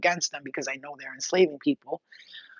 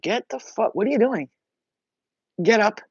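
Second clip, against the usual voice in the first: higher, mock-appalled reproach; acted protest; 5 semitones higher, 4 dB louder than usual. To followers bending on their knees, mock-appalled protest. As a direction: tell off kneeling admirers. Acts reaction to people kneeling.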